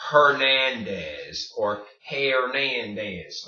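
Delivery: Hernandez with a sounded H and English vowels, drawn out; wrong Hernandez. Shown as a demonstration. Teacher shows what to avoid, Hernandez with audible H. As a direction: exaggerate the English mispronunciations of Hernandez, slowly. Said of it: "It is not pronounced".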